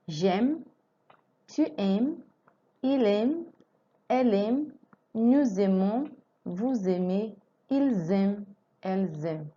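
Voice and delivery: slow recited list with rising ends; conjugation drill. Shown as a demonstration. Teacher drills aimer conjugation for students. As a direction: recite each form for repetition. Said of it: "recites conjugation drill".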